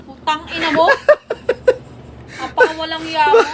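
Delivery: amused, laughing scolding; laughing curse. Real, not acted. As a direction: swear through real laughter. Cursing while cracking up at prank.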